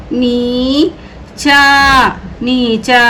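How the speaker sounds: very slow, syllable by syllable; slow drawn-out reading. Shown as a demonstration. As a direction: stretch नीचा out slowly for learners. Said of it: "teacher stretches the word for pupils".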